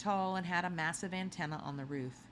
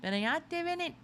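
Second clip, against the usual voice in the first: a lightly voiced big man, louder and much higher; a hint of a jolly host calling guests in; booming greeter; 13 semitones higher, 4 dB louder than usual. Embassy greeter welcoming guests at the door: lightly booming welcome. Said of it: "a huge smile and a booming voice".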